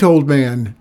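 loud, urgent hail to a stranger; shouted call. A narrator's reading. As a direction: call out across the dock. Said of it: "he heard a shout from the dock".

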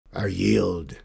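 defeated pirate giving up; low, broken pirate voice. A narrator's reading. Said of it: "he said in a low, broken voice".

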